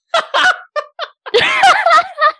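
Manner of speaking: shrieking burst of laughter; loud high laugh. Real, not acted. Big laugh at joke.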